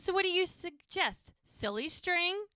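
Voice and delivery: high, loud sarcastic question; exasperated challenge. Retold or imitated. Rebuffed lady challenges Hope for an alternative, exasperated. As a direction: throw it back, stretching "Silly string?".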